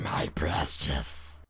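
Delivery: very low, slow creature snarl. Retold or imitated. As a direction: drag it out in a low, possessive snarl. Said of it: "looks at me with a snarl and goes".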